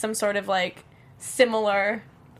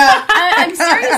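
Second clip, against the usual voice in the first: high, loud insistence through laughter; real laugh breaking into the words; laughing protest; 7 semitones higher, 8 dB louder, slower than usual. Real, not acted. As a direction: insist while still laughing. Laughing while insisting she's serious.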